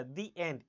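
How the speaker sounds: "the" said "dee" before end. Shown as a demonstration. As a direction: say "the" with a long ee. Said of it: "ए sound आ रहा है".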